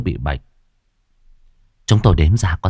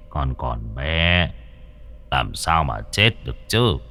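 grandfather voice; 3 semitones lower, 5 dB quieter, slower than usual. A narrator's reading. Grandfather reassuring the boy.

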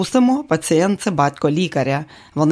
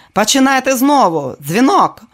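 loud command; 8 semitones higher, 5 dB louder than usual. Acted. Director ordering restart.

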